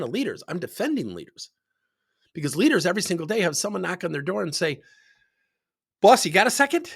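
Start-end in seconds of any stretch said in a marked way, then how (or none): 6.0-6.9 high, loud imitation of an employee; calling to boss. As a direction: call in at the boss's door, pitched up. Employee calling at boss's door.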